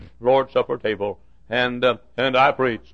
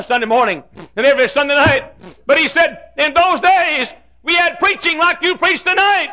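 high, loud, rising cry of remembrance; fervent shouting; 13 semitones higher, 5 dB louder than usual. Retold or imitated. Custodian recalling packed services to the preacher, fervent high shouting.

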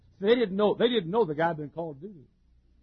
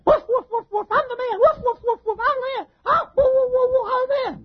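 high, loud yapping like a little dog; yapping man; 6 dB louder than usual. Retold or imitated. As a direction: bark it out like a chihuahua bragging. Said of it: "We got a lot of men".

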